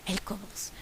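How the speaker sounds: whispered, hushed narration. A narrator's reading. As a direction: breathe it as a secret.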